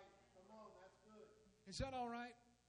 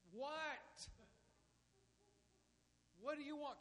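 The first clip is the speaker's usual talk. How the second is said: irritated father; 7 semitones higher, 6 dB quieter than usual. Acted. Father answering nagging child.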